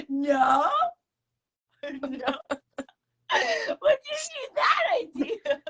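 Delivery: high squealing denial; laughing while playing innocent. Real, not acted. Repeats own denial laughing.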